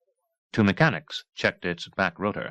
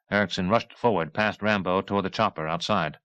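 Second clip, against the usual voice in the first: usual speaking voice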